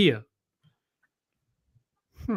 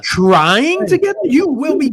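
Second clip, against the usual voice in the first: mock repeat; 10 semitones higher, 5 dB louder, slower than usual. Mocking friend's phrasing.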